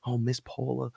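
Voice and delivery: high, soft, gushing waiter voice; sweet-talking maître d' greeting a regular; fawning waiter. Retold or imitated. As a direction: coo her name like an eager host. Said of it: "And then".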